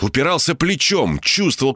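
usual speaking voice

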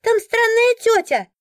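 put-on a child pointing her out; eager child. A narrator's reading. A chatty boy pointing out the stranger to the guard, high eager cry. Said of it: "любопытный и разговорчивый мальчишка".

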